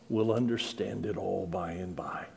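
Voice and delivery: soft reassuring whisper. Real, not acted. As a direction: breathe it out like a promise. Gentle comfort closing the thought.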